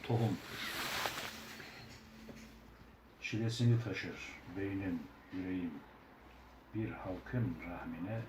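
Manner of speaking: slow, solemn poem reading; poem recitation. Acted. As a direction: recite it slowly and gravely.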